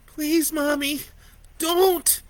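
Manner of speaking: put-on high pleading child's cry; terrified begging. A narrator's reading. Boy pleading with his monstrous mother, terrified. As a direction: beg for mercy, terrified.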